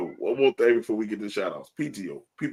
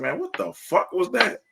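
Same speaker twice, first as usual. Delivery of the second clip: higher-pitched retold blunt reaction; blunt disbelief; 10 semitones higher than usual. Retelling blunt reaction to singing.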